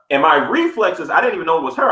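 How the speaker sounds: ordinary delivery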